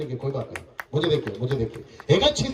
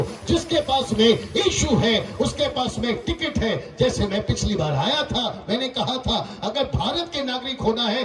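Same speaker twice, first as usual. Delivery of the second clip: emphatic preaching; 6 semitones higher than usual. Real, not acted. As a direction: declare it deliberately, phrase by phrase. Preaches to the crowd.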